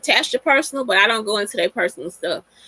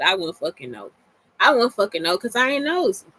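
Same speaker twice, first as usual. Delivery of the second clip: normal talking voice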